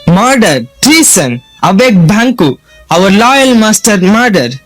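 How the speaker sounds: calling out the alarm; alarmed shout. Acted. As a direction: raise the house with a cry. Servant raising the alarm.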